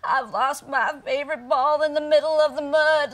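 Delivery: little frog lamenting through sobs; tearful little frog. Acted. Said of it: "little frog said".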